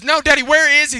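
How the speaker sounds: put-on high, loud child's protest; insistent little girl. Little girl presses Daddy again, high and insistent. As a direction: imitate a child refusing that answer.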